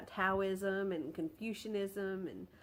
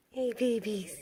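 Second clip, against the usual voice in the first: cooing at kittens; 8 semitones higher than usual. Real, not acted. She coos at her kittens in high baby talk. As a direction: coo at them like babies.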